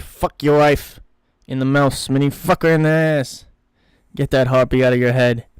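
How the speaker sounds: loud, heated telling-off; angry rebuke. Acted. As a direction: lay into a distracted partner, loudly. Acted quarrel between heist partners.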